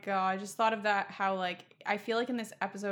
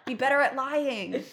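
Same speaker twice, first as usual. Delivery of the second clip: exasperated scolding. Real, not acted. Fan lecturing Toby about his bad lies, exasperated and scolding. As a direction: tell him off, begging him to do better. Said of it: "scolding Toby's lying".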